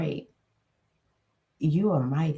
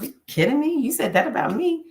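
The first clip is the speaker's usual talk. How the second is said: higher, teasing mock outrage; playful scolding; 6 semitones higher, faster than usual. Telling off her inner critic, playfully. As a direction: tease yourself like a friend. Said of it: "I'll talk like that to myself".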